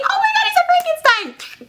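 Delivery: very high crowd outcry; mock shocked shriek. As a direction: squeal in hammed-up horror.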